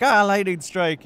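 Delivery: loud, high drawn-out cry; hammed-up startled yell; mock alarm. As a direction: burst out in sudden mock panic. Sudden disaster, acted.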